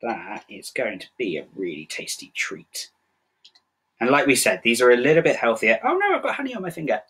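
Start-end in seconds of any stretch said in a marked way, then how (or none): 0.0-2.9 soft whisper, almost unvoiced. Real, not acted. Host whispering to viewers about the honey treat, hushed whisper. Aside over the snack. 5.8-7.0 surprised squeal. Real, not acted. Honey spills on finger.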